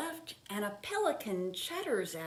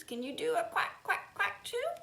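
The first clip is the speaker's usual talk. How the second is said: higher squeaky little duck asking; duckling voice; 10 semitones higher than usual. Acted. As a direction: chirp it like a baby duck. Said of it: "ducklings asking".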